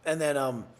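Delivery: normal talking voice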